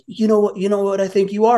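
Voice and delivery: high-pitched mock challenge; heated imitation, pitched well up; taunting drug addict. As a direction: mimic an agitated man squaring up.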